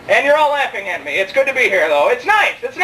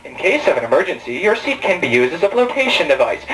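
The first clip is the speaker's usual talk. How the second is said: very low-pitched imitation of a safety announcement; mock stewardess announcement; 10 semitones lower than usual. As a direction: recite it deep, like a cabin announcement. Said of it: "those stewardesses got up".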